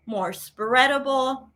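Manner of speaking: ordinary talk, nothing marked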